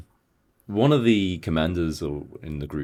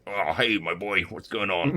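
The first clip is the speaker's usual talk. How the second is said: lightly voiced gruff cop, a touch rough; easygoing tough-guy cop greeting; gruff American cop; 3 semitones higher, 3 dB quieter than usual. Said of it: "the cop is more like a kind of a gruff".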